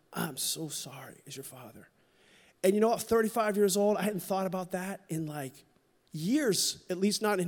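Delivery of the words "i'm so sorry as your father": very soft, low, near-breaking whisper of sorrow; barely holding back tears; choked-up father apology. Retold or imitated. Scene: father saying sorry to his son, barely audible and tearful. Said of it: "he's kinda welling up a little too".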